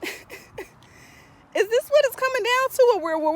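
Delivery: a laugh rising into a high, slow question; laughing disbelief. Real, not acted.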